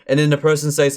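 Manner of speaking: ordinary talk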